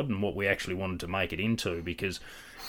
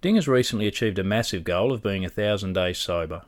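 louder, upbeat host intro; podcast intro read; 6 dB louder than usual. Acted. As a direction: sell the episode like a presenter. Reads the episode intro.

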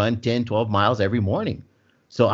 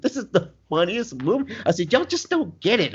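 much higher, insistent, imitating himself; insisting defensively; 7 semitones higher than usual. He defends the movie to his friends, insisting in a much higher voice. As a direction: squeak out a stubborn defense. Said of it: "I was like".

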